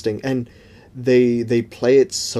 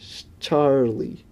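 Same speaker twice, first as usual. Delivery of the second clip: slow, drawn-out Charlie in a British person's idea of an American accent, just lightly put on; a slight British-tinged fake-American "Charlie"; failed American accent; 4 dB quieter, much slower than usual. Shown as a demonstration. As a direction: stretch out "Charlie" the way a Brit faking American would. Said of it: "I sound like a British person trying to do an American accent".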